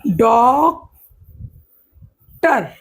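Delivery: "Doctor" with drawn-out डॉक, long pause, then टर; split into syllables. Shown as a demonstration. Teacher demonstrating two syllables to students, doctor broken in two. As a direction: pull "doctor" far apart into two beats. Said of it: "ये शब्दों को तोड़ रहा है".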